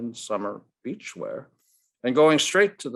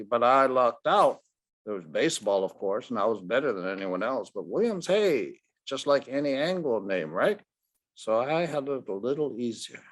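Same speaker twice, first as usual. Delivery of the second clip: put-on a brash old ballplayer talking down to a boy; gruff Ted Williams; 2 semitones higher than usual. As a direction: brush the kid off, then lay out the facts. Ted voice again.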